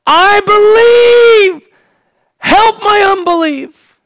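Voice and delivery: slow, high, shouted cry of belief; crying out from the depths of his soul; thunderous cry. Acted. Father crying out to Jesus, loud and thunderous. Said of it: "cries out loud and thunderous".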